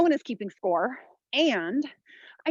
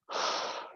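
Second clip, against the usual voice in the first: effortful sigh; 9 dB quieter than usual. Real, not acted. Mid-workout effort exhale.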